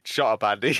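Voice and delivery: normal talking voice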